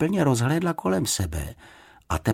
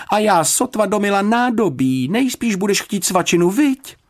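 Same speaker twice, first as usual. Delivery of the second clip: high, loud motherly fuss; a busy housekeeper caught out; 12 semitones higher, 4 dB louder than usual. A narrator's reading. Mrs Bird fussing voice.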